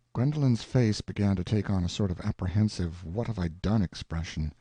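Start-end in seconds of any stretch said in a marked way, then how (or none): none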